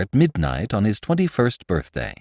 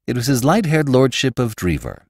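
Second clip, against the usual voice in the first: ordinary delivery, nothing marked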